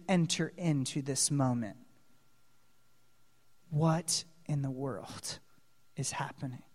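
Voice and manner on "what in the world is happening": awed hushed question; hushed wonder. Real, not acted. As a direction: ask it under your breath in awe. Preacher's hushed rhetorical wonder.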